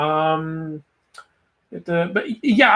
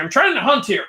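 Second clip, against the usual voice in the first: Artemis; 9 semitones higher, 3 dB louder than usual. Retold or imitated. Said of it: "Artemis is like".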